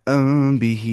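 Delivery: normal talking voice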